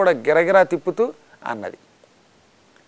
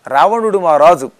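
ordinary delivery

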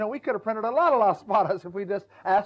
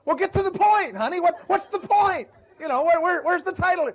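much higher and louder, exasperated; a husband pressing his wife to get to the point, much higher and louder; impatient husband; 9 semitones higher, 5 dB louder than usual. Retold or imitated. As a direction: demand the point like a fed-up husband.

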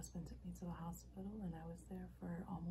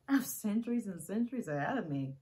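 loud, animated outburst; sighing first, then forceful; emphatic declaration; 3 semitones higher, 9 dB louder than usual. Acted. Acted monologue, emphatic second take.

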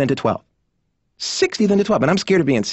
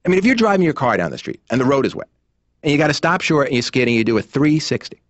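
usual speaking voice

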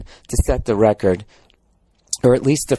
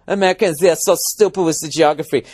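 higher, mocking Danish-accented voice; 4 semitones higher than usual. Comic replaying the cabbie's jab, mock Danish accent.

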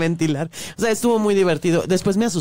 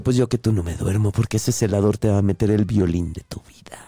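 low sinister priestess voice; 10 semitones lower than usual. Retold or imitated. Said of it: "Le digo".

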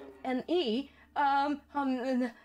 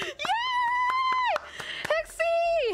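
cheering; much slower than usual. Real, not acted. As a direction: cheer for a friend arriving. Partner finally arrives.

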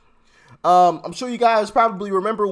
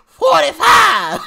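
higher, louder, slow drawn-out yell; mock rally shout; 13 semitones higher, 8 dB louder, slower than usual. Vlogger mocks Maxine Waters, bellowing it slowly. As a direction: stretch the shout like a crowd chant. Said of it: "I hate this lady's voice".

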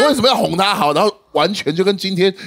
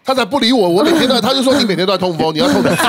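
usual speaking voice

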